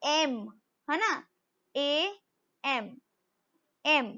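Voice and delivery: "एम" said slowly as "ए" plus "म"; spelled letter M. Shown as a demonstration. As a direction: break "एम" into "ए… एम". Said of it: "जब मैं इसको हिंदी में बोलूंगी". ("एम" को धीरे से "ए… एम" बोलें)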